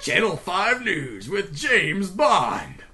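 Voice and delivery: slow, drawn-out TV intro; hammed-up newscast opener; mock news announcer. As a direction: announce the show with a flourish.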